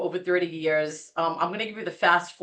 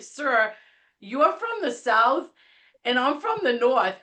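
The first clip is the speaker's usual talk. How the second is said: raised pitch, deliberate spelling-out; retold exasperated retort; 6 semitones higher than usual. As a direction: lay it out emphatically for a difficult caller. Retold firm retort to Southern caller.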